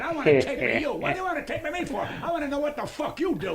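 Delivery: mock angry rant, pushed high and laughing; ranting at a young comic, half laughing; angry Italian man. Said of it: "Angry Italian man".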